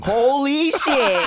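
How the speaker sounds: high, slow holy shit of disbelief; stunned amazement. Real, not acted. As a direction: drag it out, blown away. Stunned by famous co-star.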